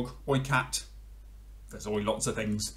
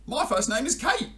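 high, eager kookaburra; a bird chirping its name; 5 dB louder than usual. Acted. Kookaburra tells frog its name, high.

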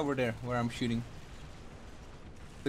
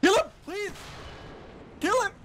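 urgent yell. Real, not acted. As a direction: scream for help under fire. Tank behind teammate, urgent plea.

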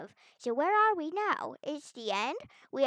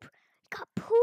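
whispered aside; slower than usual. Real, not acted. Finds poop in sewer.

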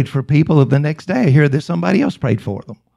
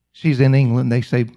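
ordinary talk

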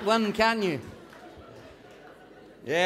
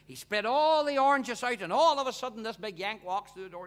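ordinary talk

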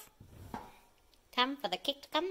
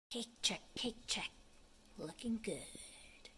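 whisper; 4 semitones lower, 14 dB quieter than usual. Real, not acted. Whispered cake check.

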